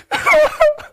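high, loud real laugh; burst of laughter. Real, not acted. Preacher bursts out laughing at his own bunny story. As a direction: break into laughter.

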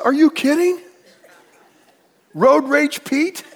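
high, loud, laughing mock disbelief; family teasing. Family mocking the pastor's patience, laughing disbelief. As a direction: tease him, dragging the nickname slowly. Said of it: "They said".

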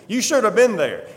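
usual speaking voice

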